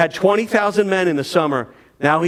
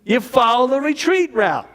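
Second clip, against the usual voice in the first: put-on New York accent; 6 semitones higher than usual. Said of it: "And he goes".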